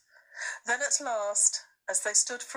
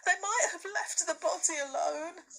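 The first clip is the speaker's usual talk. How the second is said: tearful; 10 semitones higher than usual. A narrator's reading. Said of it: "sobbed Lucy".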